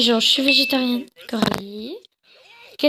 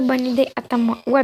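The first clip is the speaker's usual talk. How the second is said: normal talking voice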